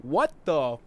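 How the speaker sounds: mild, drawn-out mock shout; understated startled yell. A narrator's reading. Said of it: "I shout as I sit up".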